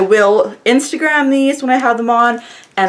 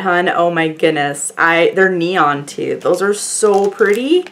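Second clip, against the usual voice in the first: normal talking voice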